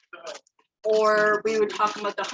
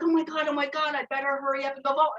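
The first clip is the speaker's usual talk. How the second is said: higher-pitched, hurried mock panic; cute freak-out; 6 semitones higher, faster than usual. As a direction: imitate an endearing little panic, speeding up. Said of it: "freaking out in the cutest way".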